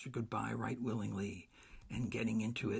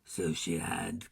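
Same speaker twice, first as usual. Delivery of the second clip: cried out; 5 semitones lower, 4 dB louder, slower than usual. Acted. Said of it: "cried Scrooge".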